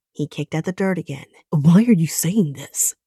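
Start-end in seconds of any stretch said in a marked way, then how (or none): 1.5-3.0 sneer. Acted. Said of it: "he sneered".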